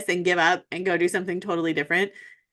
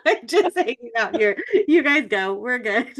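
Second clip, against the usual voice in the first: laughing; 5 semitones higher than usual. Pretend. Pretending to quit the race.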